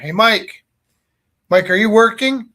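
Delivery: calling out. Retold or imitated. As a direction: hail the kid from across the class. Teacher calls student across room.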